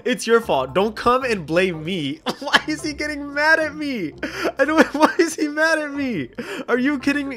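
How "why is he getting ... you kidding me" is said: high-pitched protest breaking into laughter; laughing disbelief. Real, not acted. Streamer blamed by a mad trader, laughing in disbelief. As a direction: crack up while asking, baffled.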